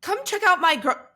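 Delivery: high, loud vlogging voice; imitated roommate. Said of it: "I've never heard you talk like this".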